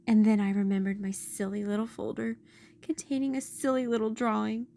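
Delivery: ordinary delivery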